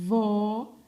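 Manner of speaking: "vó" with a buzzing v and held vowel; stretched syllable. Shown as a demonstration. Teacher pointing out the sound to children, "vó" stretched and loud. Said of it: "Olha o som".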